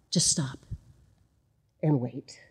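soft unvoiced hush to stop everyone; hushed whisper. Real, not acted. Pastor hushing the congregation, whispered.

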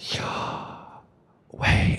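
"Yahweh" as pure breath, inhaled and exhaled, no voice; breathed pronunciation. Shown as a demonstration. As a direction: breathe "Yahweh" slowly, one whispered breath per syllable. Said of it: "breathe the name of God".

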